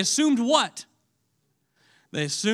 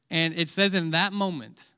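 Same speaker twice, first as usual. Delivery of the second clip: usual speaking voice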